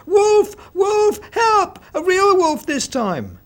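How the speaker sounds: very high, loud panicked yelling; desperate shout. A narrator's reading. Boy runs to the village, desperate shouting. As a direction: scream for help in real terror. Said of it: "he said".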